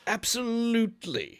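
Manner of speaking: a hint of drunken cheer; lightly tipsy agreement. Tipsy host agreeing to fetch Franny. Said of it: "he says".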